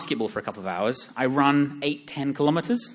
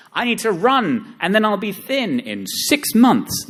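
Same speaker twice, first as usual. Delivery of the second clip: high, loud, drawn-out sing-song; mock eager resolve; 10 semitones higher, 6 dB louder, slower than usual. Mocking his own slow fitness plan, exaggerated high sing-song. Mocks slow results, quoting himself.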